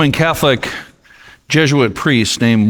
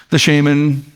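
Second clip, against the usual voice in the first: ordinary talk, nothing marked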